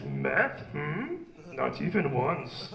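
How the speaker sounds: slow, sage little-master voice; a hint of wise old Yoda, drawn out. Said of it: "he stares at the camera and he says".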